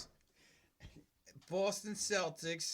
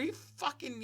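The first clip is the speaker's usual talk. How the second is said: ordinary delivery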